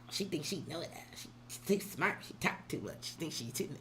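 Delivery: soft, half-whispered mimic of people who judge her; mocking gossip.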